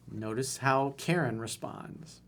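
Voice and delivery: ordinary talk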